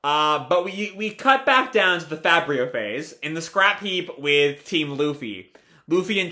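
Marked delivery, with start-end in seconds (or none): none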